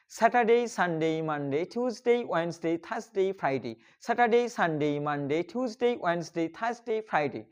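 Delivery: days chained without pauses, falling away at each week's end; in one breath. Shown as a demonstration. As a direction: rattle off the week as a connected chant. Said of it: "আমরা এক নিঃশ্বাসে". (এক নিঃশ্বাসে টানা বলা)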